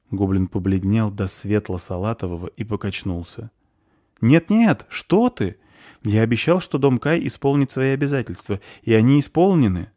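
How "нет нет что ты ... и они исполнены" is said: urgent reassurance. Acted. Reassuring shocked goblin.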